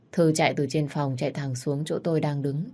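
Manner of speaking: plain reading voice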